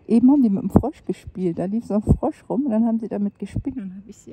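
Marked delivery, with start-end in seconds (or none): none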